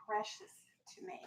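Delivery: whispered ending. Shown as a demonstration. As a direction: breathe the last words out softly. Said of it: "teacher models sentence softly".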